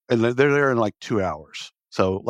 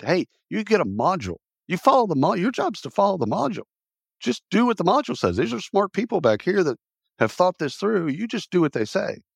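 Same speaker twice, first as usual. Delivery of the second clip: higher-pitched, insistent lecturing; mock boss scolding; 9 semitones higher than usual. Boss telling employee to follow the module, mock scolding. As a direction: lay down the rules to an underling.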